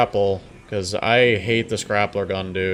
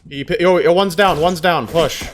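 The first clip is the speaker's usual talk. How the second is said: excited; 8 semitones higher, 7 dB louder than usual. Real, not acted. Callout after knock.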